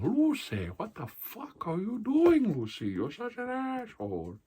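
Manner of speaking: high, loud, slow mock Cuban shout; Ricky Ricardo. Comic showing his Ricky Ricardo, loud high yell. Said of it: "I could play a better Ricky Ricardo".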